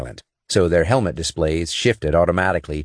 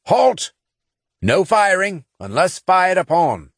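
high, loud barked order; snarled; 12 semitones higher, 3 dB louder than usual. A narrator's reading. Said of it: "Saint snarled".

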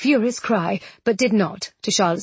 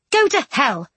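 quick, higher-pitched snub; sharp, raised-pitch refusal; annoyed retort; 6 semitones higher than usual. A narrator's reading. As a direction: throw it back at him, annoyed. Jane, annoyed, rejects the Duke.

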